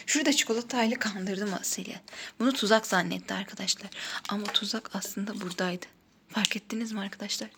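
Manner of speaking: unvoiced conspiratorial whisper. Real, not acted. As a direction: whisper the secret to viewers. Reveals trick secretly to viewers.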